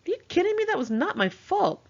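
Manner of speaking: high, loud disbelieving outburst; indignant protest. Retold or imitated. Narrator protests to Raz who blames her, indignant and high. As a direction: fire it back, outraged at the blame. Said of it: "I said".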